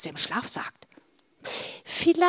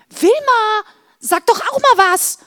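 urgent call; 13 semitones higher, 10 dB louder than usual. Acted. Sister urging silent Wilma.

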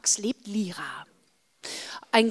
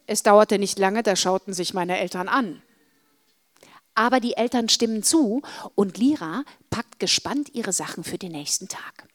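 ordinary delivery

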